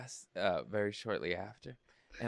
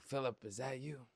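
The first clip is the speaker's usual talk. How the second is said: blind uncle; 4 semitones higher, 5 dB quieter than usual. Retold or imitated. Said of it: "He's a little blind".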